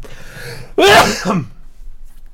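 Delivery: sudden sneeze burst; sneezing. Real, not acted. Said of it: "durch den Nieser".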